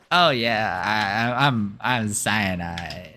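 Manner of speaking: slow, lightly put-on self voice; self-impression voice. Laughing at her story voice, slow lightly put-on self. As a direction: drawl your own name like a caricature. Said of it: "I don't get why I'm like".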